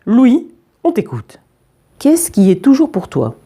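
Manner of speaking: lightly drawling teacher's question; mildly weary teacher voice. A narrator's reading. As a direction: draw the question out a little, as a teacher. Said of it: "avec une voix traînante".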